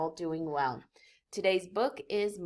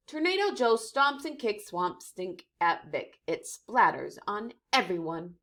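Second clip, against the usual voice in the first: ordinary delivery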